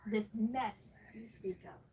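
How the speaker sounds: usual speaking voice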